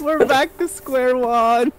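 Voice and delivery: high, drawn-out wail of defeat; laughing wail. Real, not acted. Player loses all progress, laughing wail. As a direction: stretch it out, half laughing, despairing.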